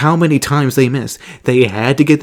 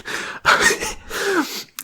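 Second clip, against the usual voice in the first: breathy high laugh; 7 dB quieter than usual. Real, not acted. Laughing at the awkward memory.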